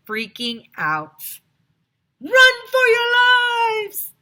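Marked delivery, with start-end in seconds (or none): 2.2-4.1 huge high, loud, slow panic yell; panicked shout. A narrator's reading. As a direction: scream the warning to everyone. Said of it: "shouted Neil".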